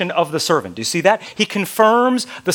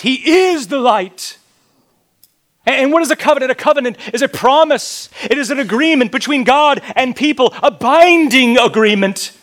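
preaching at full force; 7 semitones higher, 5 dB louder than usual. Acted. Preaching climax on the covenant.

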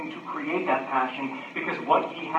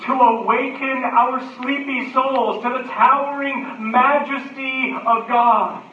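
loud, raised preaching voice; full-force preaching; 7 semitones higher, 7 dB louder than usual. Acted. Preacher urges the connection on the congregation, full-force preaching.

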